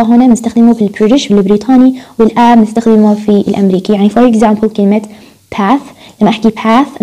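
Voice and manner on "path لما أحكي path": "path" with the front American A. Shown as a demonstration. Teacher showing American speech, front A in "path". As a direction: open front vowel in "path", as in cat. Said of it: "أنا هيك بحكي أمريكي".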